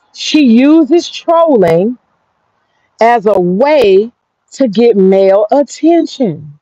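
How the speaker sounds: slow, deliberate, every word spaced; slow realization. Real, not acted. Telling her viewers what she realized, slow and deliberate. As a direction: spell out the discovery slowly. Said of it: "slow realization, drawn out".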